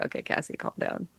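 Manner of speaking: hushed whisper to herself, almost no voice; whispered self-talk. Retold or imitated. Talking herself down at dinner, in a soft whisper. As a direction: whisper it inward to settle yourself.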